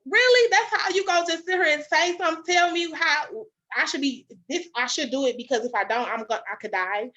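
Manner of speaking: loud, high, outraged comeback; indignant retort. Retold or imitated. Throwing the nurse's words back at her, loud and outraged.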